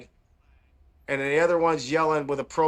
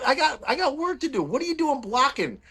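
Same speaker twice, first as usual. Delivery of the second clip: higher, exasperated pleading; retelling his complaint to the protesters, pitched up high; mock exasperation; 8 semitones higher than usual. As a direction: whine it up high, fed up.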